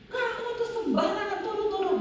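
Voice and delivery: sharp, high angry retort; angry husband. Retold or imitated. Husband lashing out at his praying wife, angry and high. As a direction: snap back at the accusing wife. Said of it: "Marah suaminya".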